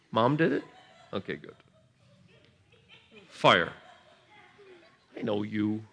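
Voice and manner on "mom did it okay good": normal talking voice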